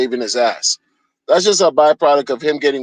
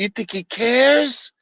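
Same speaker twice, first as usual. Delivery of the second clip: high, drawn-out rising disbelief; incredulous; 8 semitones higher, slower than usual. Real, not acted. Host scoffing at fans who trust the founder, incredulous. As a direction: stretch it upward as a scornful question. Mocking rhetorical question about the founder.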